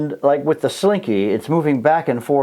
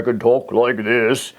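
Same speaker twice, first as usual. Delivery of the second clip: dropped into a lower, deeper register; low voice demo; 3 semitones lower, slower than usual. Shown as a demonstration. Teacher demonstrating frequency to class, low deep voice. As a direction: drop the voice down low to show another frequency. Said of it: "And that's another frequency".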